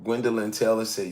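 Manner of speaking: ordinary delivery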